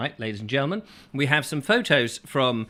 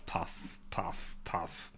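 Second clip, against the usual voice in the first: whispered puffing; 8 semitones lower, 14 dB quieter, much slower than usual. Acted. Miming puffing on a pipe.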